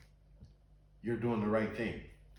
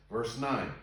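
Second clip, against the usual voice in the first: ordinary talk, nothing marked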